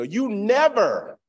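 high, loud, long-drawn NEVER; exaggerated accusation. Parent to the children, drawn-out mock scolding. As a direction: swoop up hard on the blaming word. Acted complaint to family.

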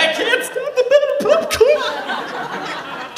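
very high, slow, laughing manic wail; losing it. Retold or imitated. Alone at the movie theater butter station, laughing manic wail. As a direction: unravel at the butter station, giggling. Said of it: "I'm fucking losing it".

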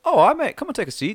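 put-on high, loud overdone British accent; an investigator welcoming the corporal in a bad British accent. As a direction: greet him in a clumsy British accent. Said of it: "That's a bad accent as well".

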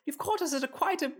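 put-on failing Irish accent. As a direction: try the accent and lose it. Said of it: "I can't do this accent".

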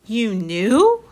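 put-on stunned boy's rising question; astonished question. A narrator's reading. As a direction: ask it in startled disbelief. Harry astonished by the news.

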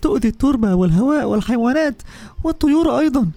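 a small bottle telling its hard story; first bottle. Acted. First bottle explaining to Lulu, mildly sad.

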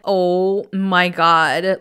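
slow, drawn-out disbelief; retold shock. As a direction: stretch out each word in mock shock. Retells own shocked reaction.

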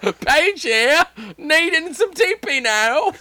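high loud cartoon demand; Cornholio as Paige. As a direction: shriek it in a Cornholio voice. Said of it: "I'm doing the Cornholio".

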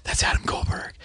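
hushed, whispered recognition; starstruck whisper. Retold or imitated. Husband spotting an actor at wife's reunion, whispering. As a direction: whisper it as a discreet aside.

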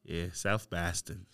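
slow, low South Boston accent; soft, unhurried Boston accent. Shown as a demonstration. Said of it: "You can do Boston".

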